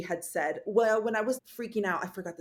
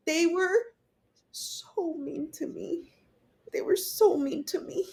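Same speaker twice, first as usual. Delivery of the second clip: high, slow, broken sob; sobbing; 8 semitones higher than usual. Retold or imitated. Mother sobbing to her partner, high and slow. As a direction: cry it out to him.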